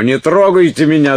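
very high, loud warding-off cry; panicked shout. A narrator's reading. Уилт fends off approaching Салли, shouting wildly. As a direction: shout to keep someone away from you. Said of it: "закричал он".

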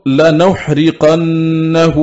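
لنحرقنه said la-nahruqannahu, slowly with a long held nn; light ra. Shown as a demonstration. Teacher showing Abu Jafar's reading, لنحرقنه slow, as la-nahruqannahu. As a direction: draw out لنحرقنه with a single r and a lingering double nun. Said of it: "بفتح النون وإسكان الحاء وضم الراء مخففة".

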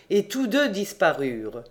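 plain reading voice

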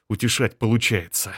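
ordinary delivery

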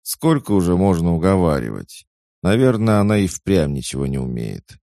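grumbling. A narrator's reading. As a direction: complain loudly that it is hopeless. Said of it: "проворчала вторая". (ворчливое недовольство)

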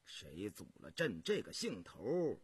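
low, contained imperial voice; stern, resolute emperor. Acted. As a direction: speak low with cold resolve, firming toward the end. Voices Emperor Yongzheng answering ministers.

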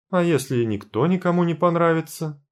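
ordinary delivery, nothing marked

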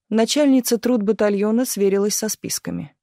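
ordinary delivery

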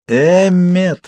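high, drawn-out plea; pleading. A narrator's reading. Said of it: "взмолилась я".

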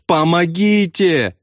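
high, drawn-out cry for help; lightly tearful slow wail; sobbing plea. A narrator's reading. Pilot on the intercom, lightly sobbing for help. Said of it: "всхлипывал Добс".

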